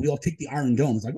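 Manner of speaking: ordinary talk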